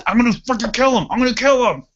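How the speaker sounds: high, fast mock rage; rapid, high-pitched retold threat; flipping out. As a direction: imitate a gunner losing it. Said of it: "The gunner flipped".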